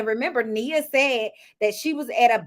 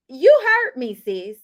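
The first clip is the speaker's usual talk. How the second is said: loud emphatic; 7 dB louder than usual. Real, not acted. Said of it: "emphatic outburst".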